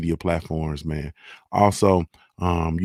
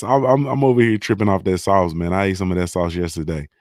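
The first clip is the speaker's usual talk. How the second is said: ordinary talk, nothing marked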